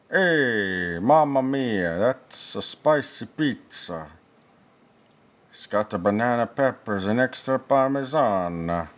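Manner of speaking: hammed-up Italian pizza chef; jolly italian pizza chef.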